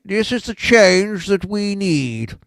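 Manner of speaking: put-on slow, drawn-out old-time politician's slogan; mock politician. Host mimicking politicians holding their lapels, slow mock speechifying. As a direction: proclaim it like a film-reel politician. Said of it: "it's always the same".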